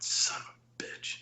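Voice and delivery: hushed, slow retold curse; angry near-whisper, imitating your own reaction; mad curse. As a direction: curse under your breath, slowly. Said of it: "I was so mad".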